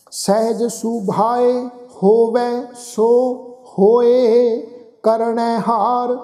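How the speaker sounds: slow, drawn-out sacred recitation; scripture recitation. Acted. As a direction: recite the verse slowly, stretching the vowels.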